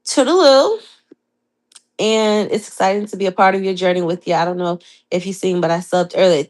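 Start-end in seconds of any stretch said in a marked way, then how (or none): 0.1-0.8 playful goodbye. Real, not acted.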